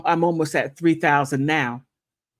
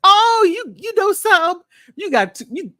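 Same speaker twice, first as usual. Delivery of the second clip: squealed, much higher and louder; playfully scandalized squeal; high mock outrage; 7 dB louder than usual. Real, not acted. Reacting to a viewer's dig with a playful high squeal.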